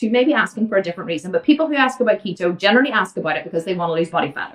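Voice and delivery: ordinary talk, nothing marked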